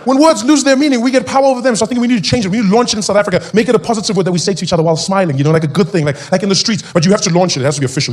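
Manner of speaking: normal talking voice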